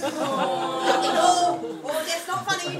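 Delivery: giggling through it; trying not to laugh but failing. Retold or imitated. Laughing at a grieving teacher, giggling.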